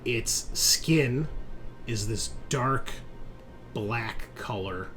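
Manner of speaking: slow, suspenseful narration with long pauses; game master scene setting. Acted. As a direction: set the scene word by word, letting key words ring. Game master describes the creature's arrival.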